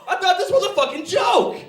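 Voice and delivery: high, loud shocked yell; incredulous outburst. Retold or imitated. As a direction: burst out in hammed-up disbelief. Shocked reaction to girlfriend's request.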